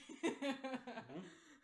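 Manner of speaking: laugh. Real, not acted. Laughing at blindfolded friend.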